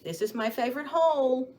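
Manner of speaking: ordinary talk, nothing marked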